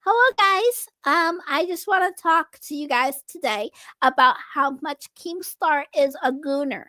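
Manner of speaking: put-on high childlike baby voice; infant voice. Shown as a demonstration. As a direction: talk like a small child. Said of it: "talk like a fucking infant".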